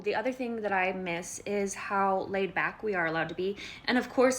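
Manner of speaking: ordinary talk, nothing marked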